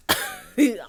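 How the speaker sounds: high, loud real laugh; burst of laughter. Real, not acted. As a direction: break into a sudden laugh. Laughing at own story.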